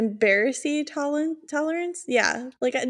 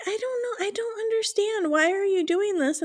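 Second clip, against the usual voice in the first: retold high-pitched confusion; baffled questioning; 8 semitones higher than usual. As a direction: plead for an explanation in a high voice. Baffled aunt questioning kids, retold.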